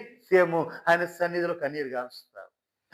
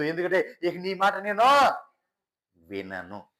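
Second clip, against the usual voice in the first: a lightly raised, stern voice of God; God's weary complaint; 6 semitones higher than usual. Acted. God tells his servant he is fed up. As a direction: let God vent his exasperation at the rebels.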